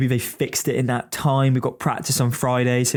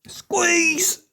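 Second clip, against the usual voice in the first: sudden high yelp; startled squeal. Real, not acted. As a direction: squeal in surprise. Reacting to traffic while driving.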